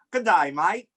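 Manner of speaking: broad put-on Australian accent. Guest gives clues in accent guessing game, mock Australian accent.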